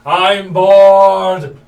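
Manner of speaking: loud bored whine. Acted. Whiny complaint at table.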